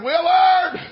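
loud, high exclamation of the name; hammed-up exasperated cry; mock outburst. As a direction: act out a startled protest. Man reacts to the church invitation.

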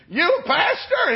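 high-pitched mock astonishment; retold squawk of disbelief; incredulous. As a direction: act out a stunned question. Man learns friend is a pastor.

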